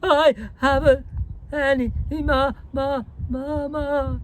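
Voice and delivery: put-on very high, drawn-out child's wail; half-hearted howl. A narrator's reading. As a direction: howl it like a tantrum running out of steam. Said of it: "first howl rather half-hearted".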